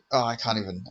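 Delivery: normal talking voice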